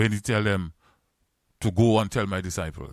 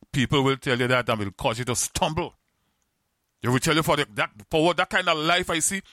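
full-force preaching; 5 semitones higher than usual. Acted. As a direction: lift the voice and plead with the crowd to remember Peter.

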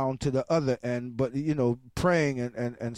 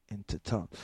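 ordinary talk

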